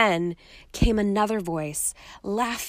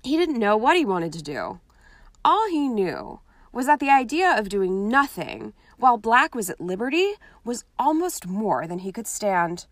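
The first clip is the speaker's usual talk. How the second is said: usual speaking voice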